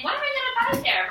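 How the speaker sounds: "What am I gonna buy there?" pitched high and quick, asked like a real question; asking a question. Shown as a demonstration. Teacher demonstrates question intonation, quick and high. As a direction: ask it like you truly want to know. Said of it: "Because I'm asking a question".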